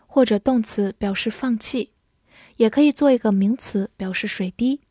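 ordinary delivery

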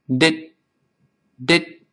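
short "did", breath stopped at the end; held final t. Shown as a demonstration. Teacher drills the last syllable, "did" with breath held. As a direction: cut "did" off with no burst. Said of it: "最后那个t憋住气".

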